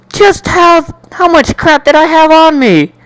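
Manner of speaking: high, loud disbelief; a high, strained wail of exasperation; exasperated outburst. Acted. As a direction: throw up your hands in disbelief.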